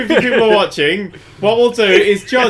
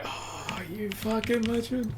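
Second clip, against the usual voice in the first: very soft, slow, breath-led admiration; hushed awe; 3 semitones higher, 16 dB quieter, slower than usual. Retold or imitated. YouTuber recalling his reaction to a devoted fan, hushed awe. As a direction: murmur it in reverent amazement.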